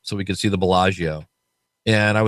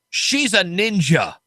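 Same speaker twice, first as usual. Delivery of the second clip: slow, slurred, dead-serious declaration; drunk friend's slow verdict; 7 semitones higher, much slower than usual. Retold or imitated. Tipsy friend solemnly explains to the stranger, slow and slurred. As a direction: drag out the words like a tipsy friend.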